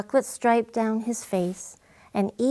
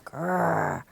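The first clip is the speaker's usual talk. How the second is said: dog growl; 4 semitones lower than usual. Acted. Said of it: "He growls while he swims".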